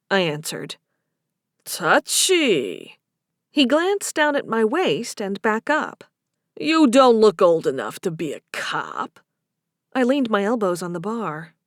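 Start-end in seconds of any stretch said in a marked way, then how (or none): 1.7-3.0 low Boston bartender voice; Boston barman, lower pitch; thick Boston accent. A narrator's reading. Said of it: "a man with a thick Boston accent". 6.6-9.2 lower-pitched Boston bartender voice; Boston barman, low; thick Boston accent. A narrator's reading. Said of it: "a man with a thick Boston accent".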